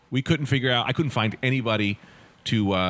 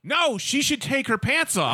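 higher, slow voice of the roommate egging them on; the roommate chiming in, higher and slow; pushy roommate; 12 semitones higher, slower than usual. Retold or imitated.

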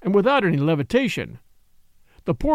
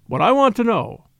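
higher, louder outburst; angry cry; 5 semitones higher, 4 dB louder than usual. Acted. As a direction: cry it out with an oath. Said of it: "cried Kalon".

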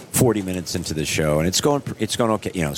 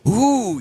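crowd gasp; 4 dB louder than usual. Retold or imitated. Crowd reacting to his fall.

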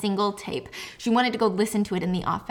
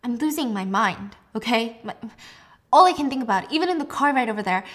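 normal talking voice

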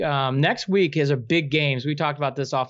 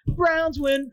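high cheering shout of Browns; excited cheer; 10 semitones higher than usual. Pretend. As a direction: celebrate the win. Repeated cheer.

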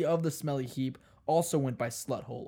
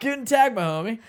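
higher, laughing bro greeting; Carl the wannabe bro; 7 semitones higher, 5 dB louder than usual. Pretend. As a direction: try hard to sound like a cool bro. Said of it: "this is my character. His name is Carl".

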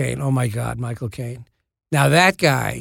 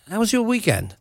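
soft, higher-pitched, rough Cockney impression; Michael Caine; 6 semitones higher, 4 dB quieter than usual. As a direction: have a go at a Cockney star, badly. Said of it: "This is a terrible Michael Caine impression".

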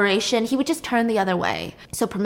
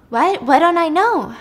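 higher, louder puzzled protest; baffled question; 7 semitones higher, 5 dB louder than usual. Acted. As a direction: ask it in acted bewilderment, pitched up. Circe confused by the taunting.

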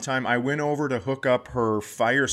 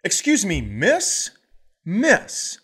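higher, insistent calling of miss; imitating mom; 8 semitones higher, 4 dB louder, slower than usual. Mom demands a park worker's attention, a bit louder. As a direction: call out to a worker, pushy. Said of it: "So my mom goes".

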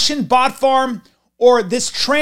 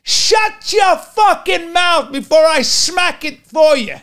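Brooklyn tough guy yell; 6 semitones higher, slower than usual. Retold or imitated. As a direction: bark it like a Brooklyn street heavy. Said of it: "we'd go".

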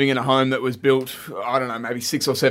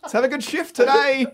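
mock coworker voice; 12 semitones higher than usual. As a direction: play a smarmy supermarket worker. Mocking a coworker's greeting.

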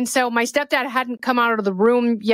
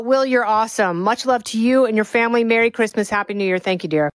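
normal talking voice

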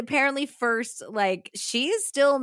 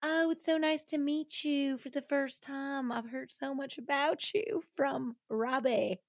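fake first meeting; 3 semitones higher, 8 dB quieter than usual. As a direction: put on a sweet stranger act. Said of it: "pretending not to know people".

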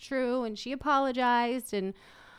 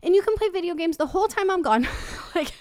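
overeager making up; 5 semitones higher, 5 dB louder, much faster than usual. Retold or imitated. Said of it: "And I'm like".

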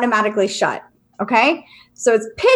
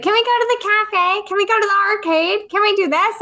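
put-on higher, pleading kid voice; whiny kids; 9 semitones higher than usual. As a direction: imitate kids nagging for more. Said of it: "my kids would be like".

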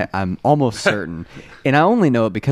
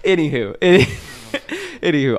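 ordinary talk, nothing marked

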